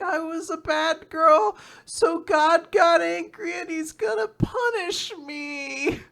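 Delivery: put-on high, slow, tearful child's voice; crying little girl. Sick girl confessing to the doctor, high slow sobbing. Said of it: "And she's sobbing".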